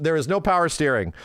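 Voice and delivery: ordinary talk, nothing marked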